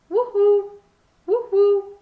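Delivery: high loud hooting call; eerie high woo-hoo; owl hoot. A narrator's reading.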